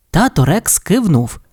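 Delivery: plain reading voice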